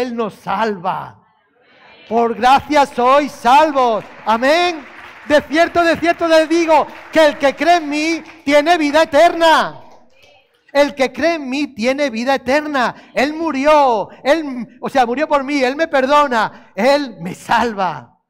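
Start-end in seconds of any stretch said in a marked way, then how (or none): 2.1-4.8 "Por gracia sois salvos" proclaimed slow and loud; preaching at full force. Acted. Preacher proclaims the verse to the congregation, slow and loud. 5.3-9.8 the verse cried out higher and louder; loud proclaimed verse. Acted. Preacher proclaims Jesus's words to the church, loud and high. 13.1-18.2 loud preaching, slowing at the end; preaching at full force. Acted. Preacher sums up the faith for the congregation, loud and slowing.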